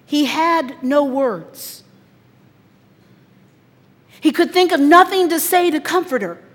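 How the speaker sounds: a pastor's voice swelling at the climax; rising emotional preaching. Acted. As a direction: swell up as words fail.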